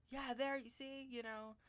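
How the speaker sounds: mock point-proving. Neighbor proving her point about the unlocked gate, mock triumphant. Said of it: "all proving her point".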